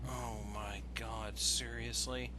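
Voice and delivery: ordinary talk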